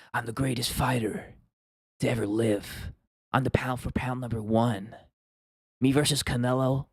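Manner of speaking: slow, hushed campfire-storyteller Usman voice; Usman impression. Streamer mocks Usman's press-conference talk, slow hushed impression. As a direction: imitate Usman murmuring a boast like a campfire tale. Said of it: "he speaks like that".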